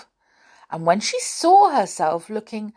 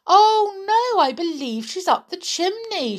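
high, astonished exclamation; 12 semitones higher than usual. Acted. Housekeeper realises her kitten is up chimney, astonished. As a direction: start on a big high "oh no", aghast. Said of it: "The housekeeper looked astonished".

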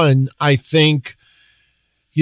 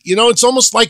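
ordinary talk, nothing marked

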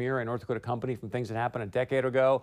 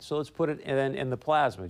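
ordinary talk, nothing marked

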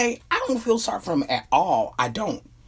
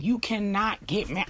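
ordinary talk, nothing marked

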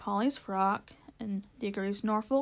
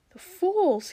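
high, loud outburst of contempt; exasperated outrage; 9 semitones higher, 7 dB louder than usual. A narrator's reading. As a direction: burst out in outrage, pitched high. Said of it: "to his utter rage and horror".

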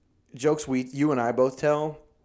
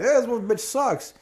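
mock husband; 9 semitones higher, slower than usual. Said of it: "No husband's not gonna be like".

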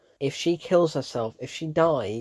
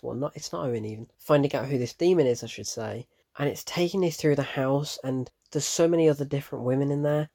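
ordinary talk, nothing marked

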